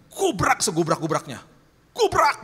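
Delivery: loud, high-pitched crash word; dramatic banging sound effect in words; imitated crash. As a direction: blurt the crash like the impact itself.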